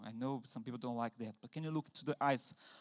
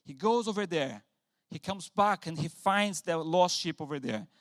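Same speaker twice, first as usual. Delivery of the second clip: normal talking voice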